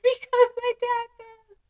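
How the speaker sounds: very high mock-crying voice; wailing child. Child confessing to teachers about dad, mock tearful wail. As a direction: whine it like a tearful little kid.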